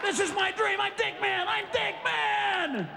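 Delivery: high, drawn-out ecstatic voice; ecstatic dreamer. Pretend. The passed-out guy in his photo, high ecstatic moaning. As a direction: moan it out in bliss.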